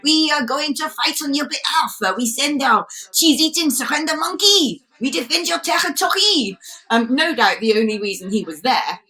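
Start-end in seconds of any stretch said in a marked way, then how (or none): 0.1-6.6 higher-pitched, hammed-up French accent; pompous mock-French declaration, pitched up; Emmanuel Macron. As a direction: caricature a French leader vowing to defend. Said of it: "Macron's turned around and flown there and said".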